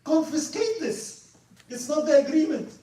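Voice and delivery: retold adviser demanding seizure, disgusted and loud. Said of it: "He was so disgusted of this person".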